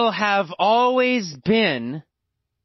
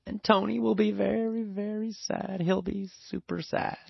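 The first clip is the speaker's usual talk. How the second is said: sad; 8 dB quieter than usual. Acted. Sad part of the story.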